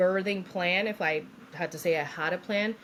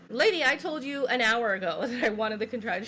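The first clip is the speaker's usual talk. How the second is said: higher, indignant retort breaking into laughter; mock exasperation; 5 semitones higher than usual. Patient reminds forgetful nurse, laughing mock exasperation. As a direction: play up the fed-up patient, amused.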